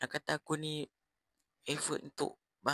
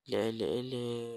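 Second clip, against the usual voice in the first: mocking eleh; 2 semitones lower, 3 dB louder than usual. Mocking the bully.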